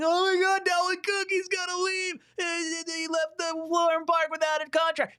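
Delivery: mock a frantic fan losing it; panicking fan. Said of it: "Everyone's panicking".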